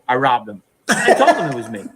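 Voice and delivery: normal talking voice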